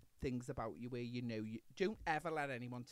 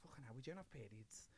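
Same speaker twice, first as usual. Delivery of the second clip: whisper; 4 semitones lower, 12 dB quieter than usual. Retold or imitated. Retold thought, sudden hushed voice.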